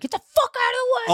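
high-pitched exasperated yell at dawdlers; impatient New Yorker. Acted. Acted impatient New Yorker yelling.

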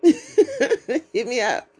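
laughing through hit me up. Real, not acted. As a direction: laugh it out, drawn slow.

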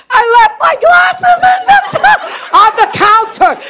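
high, laughing shout of exasperation; exasperated outburst. Acted. Comic outburst on forgotten glasses.

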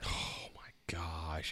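breathy hush of disbelief, slow; real slow whisper of delight; awed whisper. Real, not acted. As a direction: whisper it like you can't believe it. Overwhelmed by Baloo being next.

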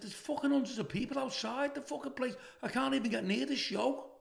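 astonished phone call to his son; stunned disbelief. Retold or imitated. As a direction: tell him the news in shock. Telling son about the crowd.

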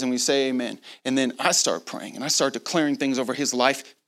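usual speaking voice

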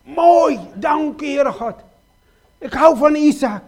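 Abraham thanking God for his son, loud and joyful. Retold or imitated. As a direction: overflow with gratitude. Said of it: "Abraham was ontzettend veel blij".

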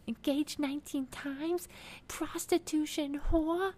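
very high, slow mock screech; hammed-up flip-out without full volume; Teresa. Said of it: "Teresa fucking flips at this point".

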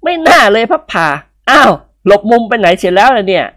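put-on high boyish voice, teasing. Son mocking his father in a high kid voice. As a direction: tease his father in a kid's voice. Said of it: "ร้องขึ้นดังๆ".

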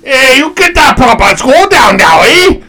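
loud, high mock Montreal Canadian accent; comic Montreal accent, building to a high yell. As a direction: shout it in a hammed-up Montreal French-Canadian accent. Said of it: "Montreal Canadian".

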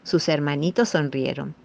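ordinary delivery, nothing marked

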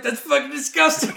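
high, loud revolted cry; disgusted girlfriend. Retold or imitated. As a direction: mimic her grossed-out outburst. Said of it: "And she's like".

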